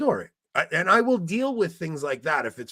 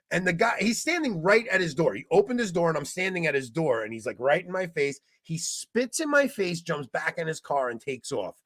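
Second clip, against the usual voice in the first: ordinary talk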